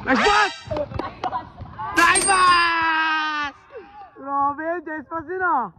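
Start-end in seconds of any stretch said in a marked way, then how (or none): 0.1-0.5 quick, loud, high cheer; short cheer. Real, not acted. Cheering a play from courtside. 2.0-3.5 long shouted cheer, last word stretched; drawn-out cheer. Real, not acted. Cheering a play from courtside.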